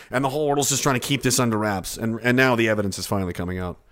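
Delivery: ordinary talk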